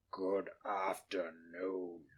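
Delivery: low, slow, hushed whisper; cold dismissal. Acted. Scrooge dismissing his nephew, hushed and cold. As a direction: dismiss him icily.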